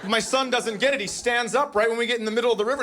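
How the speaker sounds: ordinary talk, nothing marked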